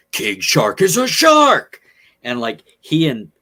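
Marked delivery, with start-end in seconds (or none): 0.1-1.8 loud, slow, high monster bellow; King Shark yell. Retold or imitated. Retelling a cartoon shark's battle cry, a loud slow bellow. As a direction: boom it out like a big dumb shark creature. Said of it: "King Shark will yell".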